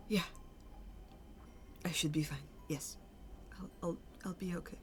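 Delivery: small, faltering reassurance; hesitant drow man. Acted. Injured drow man assures the boss he'll cope, hesitant and quiet. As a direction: trail into a quiet, stammered assurance. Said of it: "you see the drow man just kind of".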